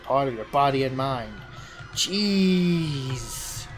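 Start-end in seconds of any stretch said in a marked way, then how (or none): 2.0-3.7 dramatic reveal. A narrator's reading. Narrator to players, booming reveal of the cheese. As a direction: announce the cheese like a holy relic.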